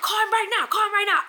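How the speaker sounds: high, loud, frantic demand; imitated spazzing. Retelling the car owner demanding a call, imitated spazzing. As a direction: mimic someone frantic, loud and insistent. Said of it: "She's spazzing, like, she's spazzing".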